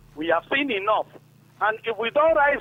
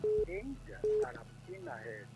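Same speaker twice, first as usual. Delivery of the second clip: muffled, faint phone voice; 4 semitones lower, 7 dB quieter than usual. Real, not acted. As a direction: speak away from the mouthpiece, muffled. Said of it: "why is your voice muffled".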